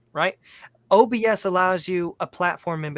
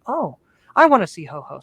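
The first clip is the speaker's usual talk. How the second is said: put-on eager child; 7 dB louder than usual. Son begging to see Santa.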